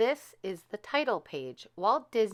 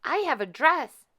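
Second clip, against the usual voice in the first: high, louder happy call; breathless joy; excited; 9 semitones higher, 5 dB louder than usual. A narrator's reading. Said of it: "she called".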